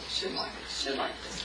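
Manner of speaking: whispered self-scolding fading away. Retold or imitated. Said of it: "And then you think".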